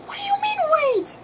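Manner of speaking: squeaky, high mock outrage; incredulous. Labouring mother answering the nurse who said wait, incredulous. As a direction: throw it back in high disbelief.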